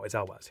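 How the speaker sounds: low, soft Elwes, first syllable stressed; pronunciation of Elwes. Shown as a demonstration. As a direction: repeat Elwes quietly as EL-wez. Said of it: "So there's the pronunciation".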